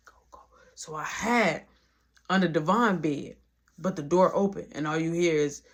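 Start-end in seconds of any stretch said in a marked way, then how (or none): 0.1-0.4 barely audible retold whisper; hushed urging. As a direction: whisper to hurry someone into hiding. Hushed order to hide.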